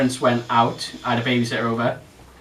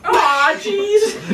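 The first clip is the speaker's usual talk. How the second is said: high, loud laughing shout of aw jeez in American; 5 dB louder than usual. Pretend. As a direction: yelp it like a cartoon American. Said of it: "I'm American".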